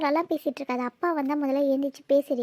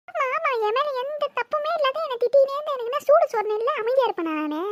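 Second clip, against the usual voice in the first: much higher, crying angry protest; tearful outburst; 10 semitones higher than usual. Acted. Scolded daughter wailing at her sister that she is blameless. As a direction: wail it out, hurt and furious.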